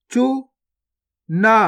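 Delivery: गुफा drawn out very slowly, each sound held long; slow stretched reading. Shown as a demonstration. Teacher showing pupils each sound, drawn out very slowly. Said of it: "पहले तो आपको शब्द को गौर से सुनना है".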